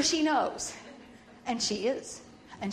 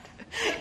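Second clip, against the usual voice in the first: high burst of laughter; 12 semitones higher than usual. Real, not acted. Laughing at cousin's remark.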